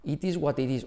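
"It is what it is" with hard Ts, Spanish accent; a learner reading "It is what it is" aloud in Spanish-accented English. Shown as a demonstration. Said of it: "A que si tú dices".

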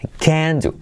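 "can" stressed, no T before "do"; stressed CAN, silent T. Shown as a demonstration. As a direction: hit "can" and glide into "do" without a T. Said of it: "Se acentúa el can y no se pronuncia la T". ("can" acentuado, con la T muda)

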